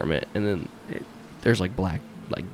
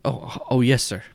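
nervous cop agreeing; 3 semitones higher than usual. Acted. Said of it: "you hear him go".

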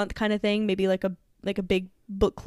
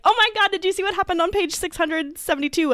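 high-pitched imitation of an overexcited reader; mock breathless fangirl excitement; excited blurting; 9 semitones higher, 3 dB louder than usual. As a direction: gush it like someone bursting to spill a spoiler.

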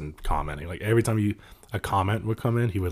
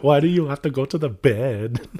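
higher, hammed-up fake Russian accent; bad Russian accent; 5 semitones higher, 4 dB louder than usual. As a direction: have another go at a clumsy Russian accent. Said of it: "That's not even a Russian accent".

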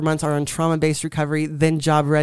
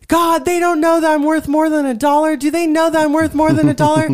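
indignant plea to God; 11 semitones higher, 5 dB louder than usual. Retold or imitated. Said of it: "delivering pizza".

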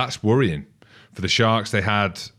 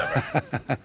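short laugh, raised in pitch; a real, light laugh; amused laugh; 5 semitones higher, 5 dB quieter than usual. Real, not acted. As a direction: chuckle at the guest's answer. Amused by guest's answer.

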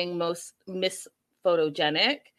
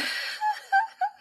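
squealing laugh; shrill giggle; high giggle. Real, not acted. Laughs at the glasses.

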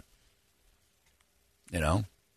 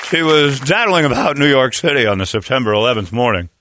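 a hint of an old-time radio narrator building suspense; mock radio broadcaster telling the rest of the story; Paul Harvey; 5 semitones higher than usual. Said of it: "I'm Paul Harvey when it comes".

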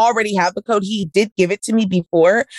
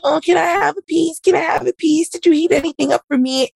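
much higher, pleading mock voice; begging boyfriend; 8 semitones higher than usual. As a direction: whine for a piece like a needy boyfriend. Mimics boyfriend begging for chicken.

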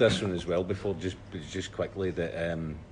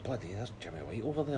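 awed thought; 7 dB quieter, faster than usual. Retold or imitated. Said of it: "I was like".

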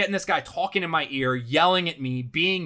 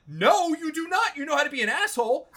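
exasperated inner retort; 10 semitones higher than usual. Retold or imitated. Golfer silently answering his caddy back, indignant. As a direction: protest in a high, outraged voice. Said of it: "in my head I'm like".

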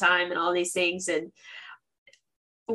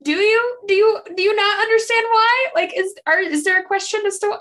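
incredulous; 11 semitones higher, 4 dB louder than usual. Acted. Mocking a character's line.

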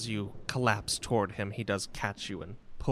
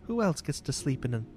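consoling mother; 5 semitones higher, faster than usual. Acted. Mother promising Mick an adventure together, tender and consoling. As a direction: coax gently in a high, airy voice.

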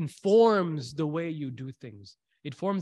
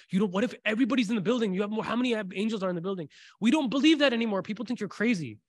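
excited; 6 semitones higher, 4 dB louder, faster than usual. Real, not acted. Exclaiming about angels.

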